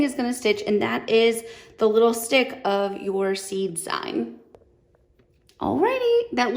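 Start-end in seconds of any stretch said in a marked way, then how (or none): 5.6-6.2 high bright sing-song call; cheery high-pitched alrighty. Real, not acted. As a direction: chirp it up like a happy cue. Cheerful sing-song cue after stitching.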